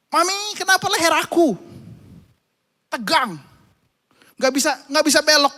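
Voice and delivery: put-on a small child in pain calling mami. Sore-necked son calls his mother, high and whiny.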